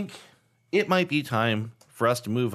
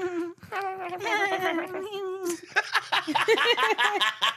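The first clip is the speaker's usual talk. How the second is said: long, high creepy cackle; creepy laugh. Real, not acted. Host failing at a voice, creepy high laughter. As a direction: let a high, eerie laugh run on and on.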